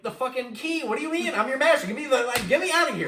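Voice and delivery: high, loud frustrated yelling; mock angry outburst. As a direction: rant at the stubborn genie. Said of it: "And be like".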